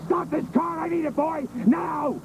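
high urgent shouting. Acted. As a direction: demand they stop the car, now. Demanding the car stop.

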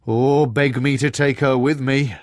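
a giant half dreading the thought; frost giant voice. Acted.

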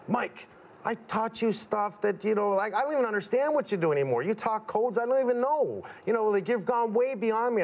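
high, earnest retelling of a father's admission; emotional dad. Retold or imitated. Dad admitting his son has outgrown him, earnest. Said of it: "because that's when he goes".

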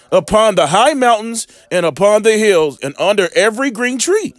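measured, solemn Bible reading; scripture reading. Acted. Preacher reading Deuteronomy to his viewers, solemn scripture reading. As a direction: read the verse like holy law.

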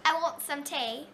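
a playful attempt at an English accent; a mock English "I want some tea"; teen trying a mock English accent. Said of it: "an England accent".

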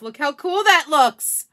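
loud, slow delight; excited. Real, not acted. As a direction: admire it out loud. Excited crafter admiring her work.

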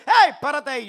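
loud, high halt order; policeman's shout. Retold or imitated. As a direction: imitate a cop yelling to stop. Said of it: "había otro policía, me dice".

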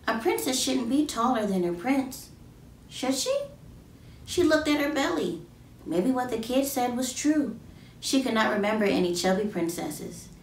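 ordinary delivery

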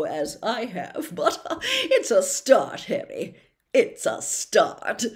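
put-on a preening celebrity patronizing a boy; loud, chuckling, condescending charm; Lockhart voice.

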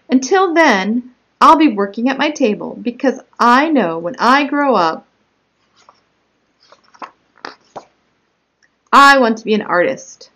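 animated story narration with punched key words; picture-book read-aloud. Acted. Child narrator telling of her own spot, read as lively story narration. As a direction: read it out clearly and expressively to young children.

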